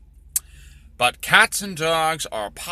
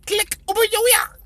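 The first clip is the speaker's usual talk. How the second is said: much higher caricature gibberish voice; mock gibberish. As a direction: squeak out mocking nonsense words. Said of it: "they say weird things like".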